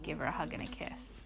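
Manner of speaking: ordinary delivery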